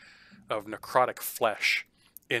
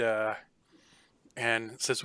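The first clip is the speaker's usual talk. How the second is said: normal talking voice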